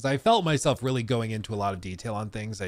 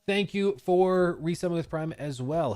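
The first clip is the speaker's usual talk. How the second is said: normal talking voice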